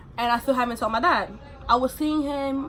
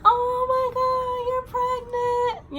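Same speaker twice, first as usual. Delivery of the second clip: mock their fake squeal of delight, much higher; fake excited friends; 14 semitones higher than usual. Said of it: "all this fake fucking love".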